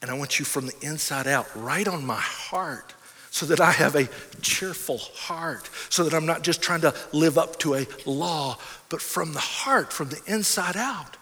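soft, hushed closing prayer. Acted. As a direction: pray it quietly and earnestly. Closes in prayer.

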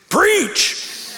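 high, booming call of Preach!; booming friend's call. Retold or imitated. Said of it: "he called me Preach".

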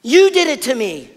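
loud, high, bitter blame; frustrated accusation. Retold or imitated. Naomi blaming God, loud and high. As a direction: point the finger hard at God. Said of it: "She goes".

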